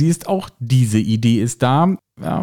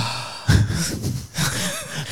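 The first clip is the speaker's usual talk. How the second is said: breathy laugh; 4 semitones higher, 7 dB quieter than usual. Real, not acted. Laughing at headphone voice.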